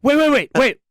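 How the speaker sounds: high, urgent repeated wait; frantic call. Retold or imitated. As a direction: call him back in a hurry. Frantically calls [name] back.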